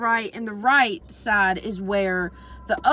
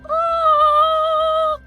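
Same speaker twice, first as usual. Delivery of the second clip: very high, loud sung-out oh; imitating a tripping friend's strange high wail; super high-pitched oh; 11 dB louder than usual. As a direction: hit a piercing high note on oh. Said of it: "super fucking high pitch ass voice".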